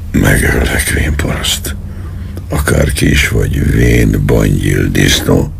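low, grim villain's snarl; menacing threat. A narrator's reading. Colonel threatening the old man at gunpoint, low menacing voice. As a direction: growl the insults down low, lightly voiced.

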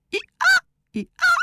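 very high, squealed double hee-haw; donkey bray. A narrator's reading. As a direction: bray twice, leaping high. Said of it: "szólt erre Füles". (éles, magas szamárordítás)

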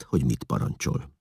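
ordinary delivery

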